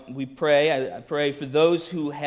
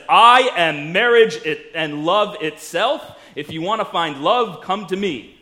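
louder, slow grandiose declaration; a loud, hammed-up wedding crasher; mock grand proclamation; 3 semitones higher, 9 dB louder than usual. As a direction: proclaim it pompously from the back. Schmo interrupts the wedding.

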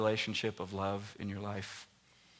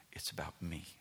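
hushed God; 6 semitones lower, 10 dB quieter than usual. Acted. God's reply to Moses, hushed.

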